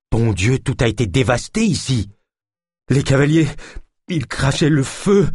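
high, alarmed outburst with halting breaks; frightened man reporting a disaster; panicked stammer. A narrator's reading. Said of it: "bredouilla Jacquenot".

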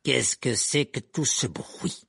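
slow, smooth, threatening put-on voice; silky and menacing. A narrator's reading. Rogue arrives on the fight, silky and menacing. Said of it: "une voix doucereuse et menaçante".

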